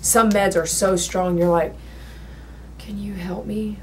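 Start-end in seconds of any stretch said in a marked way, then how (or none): 2.8-3.8 much softer, nearly whispered, as if too drugged to speak; a faint plea from a hospital bed, whispered; weak, faint plea. Retold or imitated. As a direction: barely voice it, sapped of strength. Drugged patient too weak to talk.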